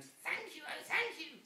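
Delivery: high grateful witch thanks; high witch thank you. Acted. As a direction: thank them like a relieved witch. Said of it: "the grateful witch cried".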